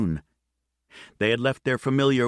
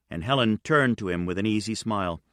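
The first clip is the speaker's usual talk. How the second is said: usual speaking voice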